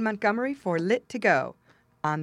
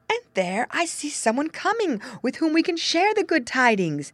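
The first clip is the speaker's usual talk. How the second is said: slightly acted, higher-pitched storybook character; lightly voiced rooster; 7 semitones higher, 3 dB louder than usual. Rooster telling the fox someone is coming, lightly acted high voice. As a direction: lift the pitch a little for the rooster.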